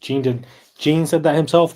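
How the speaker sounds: loud, high insistence; excited. Real, not acted. Insisting Gene said it.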